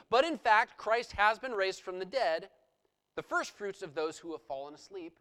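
loud, emphatic preaching of the passage; scripture reading. Acted. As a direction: proclaim it to the congregation. Continues reading the letter.

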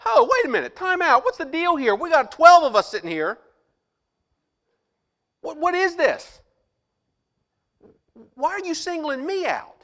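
high, loud, baffled Peter; imagined Peter. Retold or imitated. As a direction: play Peter protesting. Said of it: "he had to be sitting here going".

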